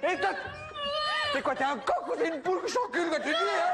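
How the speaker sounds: fast, high shouted taunt with a laugh; high laughing yell of provocation; aggressive shout. Acted. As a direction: hurl the insult up high. Rooster player picks a fight.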